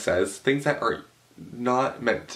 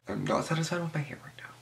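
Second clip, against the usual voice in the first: soft unvoiced aside to the viewer; whispered aside; 7 semitones higher, 6 dB quieter than usual. Real, not acted. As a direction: murmur it off to the side.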